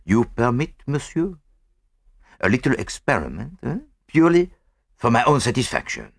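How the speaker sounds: lightly voiced, polite French detective; courteous French-accented detective. A narrator's reading. Poirot asks the inspector's leave, politely. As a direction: request permission with a slight French accent.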